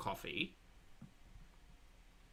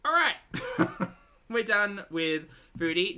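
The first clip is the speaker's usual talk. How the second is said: laughing; 8 semitones higher, 7 dB louder than usual. Real, not acted. Laughing wrap-up.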